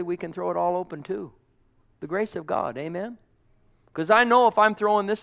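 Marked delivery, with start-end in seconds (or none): none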